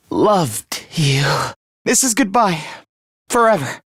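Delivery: halting last words, long pauses; dying farewell. Acted. Last goodbye to Takumi, halting and fading. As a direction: force out each phrase as strength fades.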